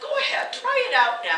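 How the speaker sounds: high, loud eager push; pushy urging. Acted. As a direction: push her, grinning, to try it.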